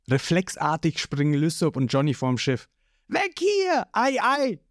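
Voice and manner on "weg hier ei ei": panicked. A narrator's reading. They flee in panic.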